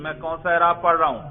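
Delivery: ordinary talk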